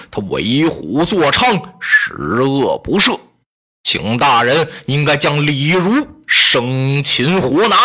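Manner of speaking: low, slow and measured counsel; a warrior advising the minister gravely; earnest general. Acted. Lü Bu urges Wang Yun to seize Li Ru, low and grave.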